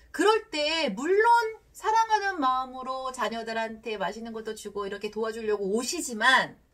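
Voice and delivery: usual speaking voice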